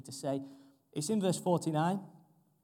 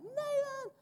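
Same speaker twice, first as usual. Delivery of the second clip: extremely high, squealed year seven call; escalating schoolkid shout. Retold or imitated. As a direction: push the mock schoolboy yell right up high. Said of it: "It's getting louder and louder".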